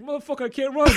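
very high and loud mock yell; hammed-up angry yell from wife in labor. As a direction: imitate her shrieking at him while he runs. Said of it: "She's like".

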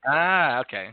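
usual speaking voice

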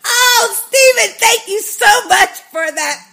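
excited thanks. Real, not acted. Excited thanks for listener audio.

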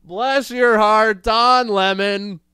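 slow, high, loud mock Southern drawl on bless your heart; sarcastic Southern put-down, stretched out slowly. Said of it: "as we say in the South".

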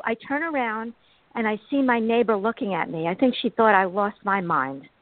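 ordinary talk, nothing marked

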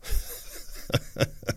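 short breathy mocking chuckle; quiet scornful laugh. Real, not acted. Host laughing at the mayor's clip, scornful chuckle. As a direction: laugh him off under your breath.

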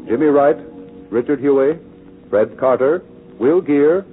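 ordinary talk, nothing marked

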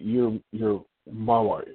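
my leaned on, slow and deliberate; stress on my. Real, not acted. Husband telling the show his wife is his, stress on my. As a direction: lean on my so it claims her. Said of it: "intentionally with emphasis on, on my".

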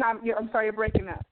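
ordinary talk, nothing marked

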